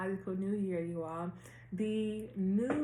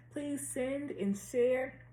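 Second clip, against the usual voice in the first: very slow, drawn out; 6 semitones higher, much slower than usual. Real, not acted. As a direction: stretch every word out. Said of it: "I'll be talking slow".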